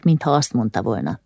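usual speaking voice

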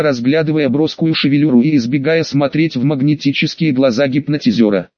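usual speaking voice

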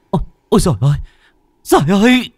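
high, frightened outcry climbing sharply; startled cry. A narrator's reading. Child spots a shadow on the fence, startled scream. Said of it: "Tôi giật thót mình kêu lên".

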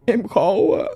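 crying lament. Retold or imitated. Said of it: "thằng này nó khóc".

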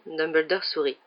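ordinary delivery

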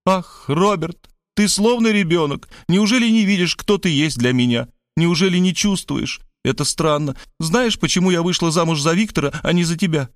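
high, emotional woman's voice; motherly exclamation. A narrator's reading. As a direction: exclaim with fond reproach, pitch well up. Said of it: "матерински чмокнув меня в макушку, воскликнула".

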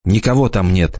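plain reading voice

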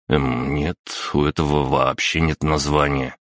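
usual speaking voice

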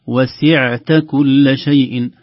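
"وسعت" with a voiced, steady ain, slowly chanted; correct pronunciation. Shown as a demonstration. Teacher models the correct ain, slow chant. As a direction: hold the ain in "وسعت" voiced, not breathy. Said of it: "لاحظوا حرف العين".

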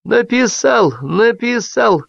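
high, mechanical repetition, slow at the end; like a broken record. A narrator's reading. Guest repeats himself like a broken record, high and slow. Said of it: "как испорченная пластинка".

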